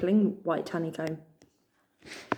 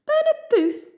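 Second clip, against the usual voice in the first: much higher, louder laughing scoff; 7 dB louder than usual. A narrator's reading. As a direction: laugh the name off in disbelief. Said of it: "she scoffed".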